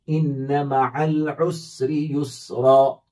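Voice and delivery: युसरा ending on a long आ, not युसरन; pausal alif ending. Shown as a demonstration. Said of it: "युसरन नहीं पढ़ेंगे". (युसरा लंबे आ के साथ)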